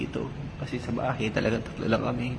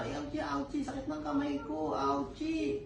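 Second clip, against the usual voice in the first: lightly voiced child in pain, higher; mock whimper of a hurting kid; pretend hurting child; 14 semitones higher, 8 dB quieter, slower than usual. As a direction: play the child saying it hurts, softly. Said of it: "Iyak ka".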